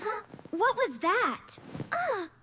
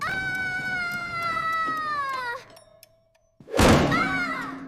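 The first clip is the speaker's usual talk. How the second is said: panicked scream; 8 semitones higher than usual. Acted. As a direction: scream as you drop. Characters panic while falling.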